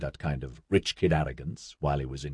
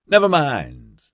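bright, higher and louder consolation; cheerful; 5 semitones higher, 5 dB louder, slower than usual. A narrator's reading. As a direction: console him with breezy cheer. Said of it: "said cheerfully".

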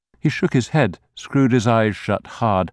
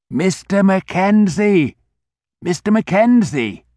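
put-on high, loud anxious old grocer calling a name; 10 semitones higher, 5 dB louder than usual. A narrator's reading. Old grocer calling the fallen Mister Mackenzie, high and loud. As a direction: call out to him, worried and urgent.

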